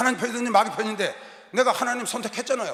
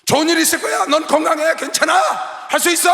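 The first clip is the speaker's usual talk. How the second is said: mock cheerful shout; 6 semitones higher, 5 dB louder than usual. Quoting positive self-talk.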